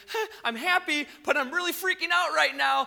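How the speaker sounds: half thrilled, half panicking; happy but freaking out. Retold or imitated. Women after the angel's news, mock jittery excitement. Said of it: "they're gonna be kind of like".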